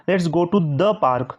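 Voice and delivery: slow, with "the" given weight; stress on the. Shown as a demonstration. Teacher stressing the article for students. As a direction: lean on "the" before "park". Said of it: "तो park के पहले क्या लग जाएगा".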